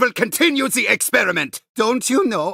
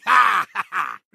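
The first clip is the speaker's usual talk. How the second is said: evil laugh; 3 semitones lower than usual. Acted. Villain laughs at players.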